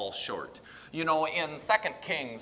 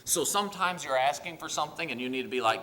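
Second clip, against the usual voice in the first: ordinary talk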